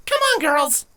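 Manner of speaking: put-on high, bright young pony calling her friends; rally the girls with a high, eager voice; excited young filly urging friends on; cheery filly voice. Avia rallies her friends.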